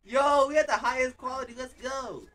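high, loud cheer; celebratory high-pitched shouting, easing off; excited hype. Real, not acted. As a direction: hype up the viewers. Celebrating the video quality.

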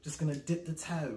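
badly faked English accent; a tentative stab at an English accent. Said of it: "I can do a really bad English accent".

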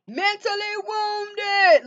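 high, slow, stretched-out words; drawn-out quoted proclamation. Acted. Voices what the cards keep saying.

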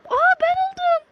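high, loud startled exclamation; surprised shout. Real, not acted. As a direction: cry out in disbelief. Repeats the realisation.

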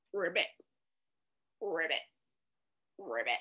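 very low, soft ribbits; frog croak. Pretend. Reader plays frog for the children, croaking low. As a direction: drop down into a small croak. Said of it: "Croak like a frog".

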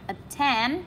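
attend with a rise on TEND; at-TEND, voice going up at the end; stress on second syllable. Shown as a demonstration. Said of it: "lên giọng này".

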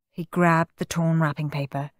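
ordinary delivery, nothing marked

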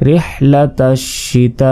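রিহলাতাশ শিতাই said slowly with a drawn vowel; রিহলাতাশ শিতাই recited slowly; slow recitation. Shown as a demonstration. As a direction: stretch the vowel four beats, slowly. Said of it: "চার second টেনে পড়তে হবে".

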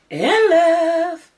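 high, loud swoon; gushing adoration. Real, not acted. Vlogger telling viewers she adores the palette, gushing. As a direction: belt it out high, smitten.

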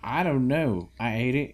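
usual speaking voice

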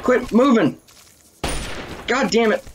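high-pitched frustrated cursing; worked-up gamer exasperation; exasperated. Real, not acted. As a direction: complain high at a target that won't hold still. Keeps missing game enemies.

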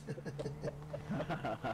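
soft trailing chuckle; real laughter. Real, not acted. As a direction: laugh quietly at your own joke. Laughs after own joke.

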